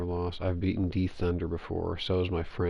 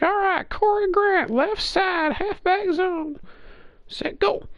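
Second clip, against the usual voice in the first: high, loud announcer-style call; mock play call shout; 4 dB louder, slower than usual. Gamer calling his play to his rival, high loud mock shout. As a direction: bellow the play like a dare.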